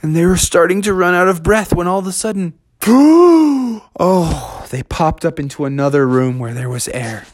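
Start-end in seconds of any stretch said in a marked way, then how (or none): 2.8-3.9 loud high gasp; gasp for air. Acted. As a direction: gasp after swimming too long. Surfacing out of breath.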